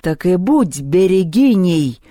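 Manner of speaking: loud, slow thunderous pronouncement; booming. A narrator's reading. Перун pronounces Люба a берегиня, booming. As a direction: boom it out word by word. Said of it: "грохнул Перун".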